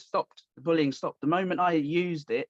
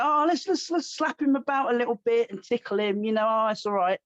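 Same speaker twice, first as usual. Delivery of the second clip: high, silly voice, as if sparring were a game; hammed-up innocence; mocking playful tone; 6 semitones higher than usual. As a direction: make fun of people who want to just play around.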